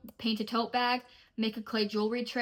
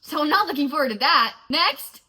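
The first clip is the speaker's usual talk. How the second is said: loud dread; 6 semitones higher, 9 dB louder than usual. Real, not acted. Dread then moving on.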